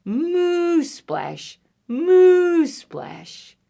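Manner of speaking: long moos, each ending in a splash; big stretched cow moos; cow mooing. Acted. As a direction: draw out the moo like a cow, then splash. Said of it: "Cow says".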